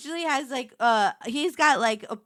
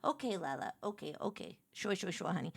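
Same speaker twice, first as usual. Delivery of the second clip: mock humoring; 5 semitones lower, 13 dB quieter than usual. Relatives humoring her at Thanksgiving.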